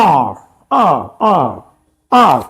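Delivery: आ, one syllable with no v-glide; "hour" as आ. Shown as a demonstration. Teacher demonstrates another way some say "hour", a drawn-out आ. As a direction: say "hour" as one long open आ. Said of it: "कोई बोलता है".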